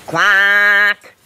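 long, level quack on one note; monotone held quack; faulty duck quack. A narrator's reading. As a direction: explain with a drawn-out duck quack. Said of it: "explained Quentin".

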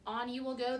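plain reading voice

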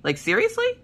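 high, disbelieving seriously; stunned at the ordeal; incredulous. Retold or imitated. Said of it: "And I'm like".

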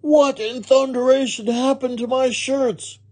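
high-pitched outraged bellow; Mister Rogers roaring. A narrator's reading. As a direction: roar in indignation. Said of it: "Mister Rogers roared".

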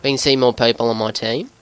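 put-on Aussie gamer commentary; Australian accent. Gamer talking viewers through a match, Australian accent. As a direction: narrate the game in an Australian accent.

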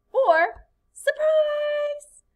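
very high, slow, loud surprise; surprised. Shown as a demonstration. Teacher showing surprise to students, high and drawn out. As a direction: stretch it out in amazement. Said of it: "express a strong feeling, such as".

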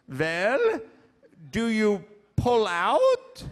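hint of a German accent, drawn out; Doctor Ruth German accent. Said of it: "she had this little high little German voice".

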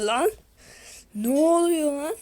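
normal talking voice

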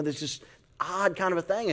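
ordinary delivery

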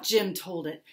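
plain reading voice

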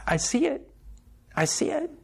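the moment it finally clicks; rising revelation. Retold or imitated. Preacher reliving his own conversion.

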